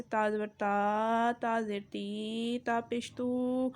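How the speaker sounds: تا زبر تا, تا زیر تی, تا پیش تو said slowly with stretched vowels; slow stretched syllables; drawn-out wrong reading. Shown as a demonstration. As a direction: show the mistake by dragging the vowels. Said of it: "اسے کھینچ کر نہیں پڑھنا".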